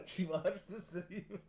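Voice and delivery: laughing too hard to say it quickly. Real, not acted. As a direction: drag it out while cracking up. Cracking up as he repeats it.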